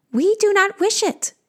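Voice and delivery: high-pitched creature voice; creatures' refusal. Acted. Two creatures refusing the queen's gift, high-voiced. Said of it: "they say in unison".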